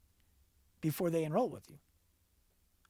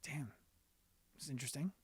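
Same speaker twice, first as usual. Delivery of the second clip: hushed aside; 3 semitones lower, 12 dB quieter, faster than usual. Retold or imitated. Inner reaction to her call.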